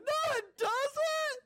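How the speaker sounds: mock defiant kid. Kid snapping back at mom who claims spanking hurts her. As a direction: fire it back like a sulky child. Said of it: "I'd look at me like".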